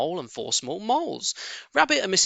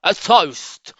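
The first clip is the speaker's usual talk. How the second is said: loud, raised call; 5 semitones higher, 8 dB louder than usual. Acted. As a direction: call the room to attention. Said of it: "called out".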